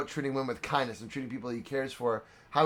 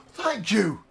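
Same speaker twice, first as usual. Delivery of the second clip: lightly voiced gambler, higher and earnest; pleading gambler; 6 semitones higher, 4 dB louder than usual. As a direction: plead to be let go as the cornered gambler. Gambler begs to be freed.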